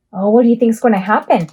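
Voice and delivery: ordinary talk, nothing marked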